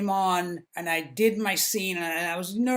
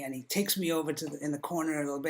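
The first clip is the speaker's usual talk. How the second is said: ordinary delivery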